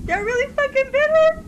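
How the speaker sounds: high, rising incredulous question; can't believe what just happened; stunned disbelief. Real, not acted. Player shocked that the zombie bit her, stunned disbelief. Player reacts to character bitten.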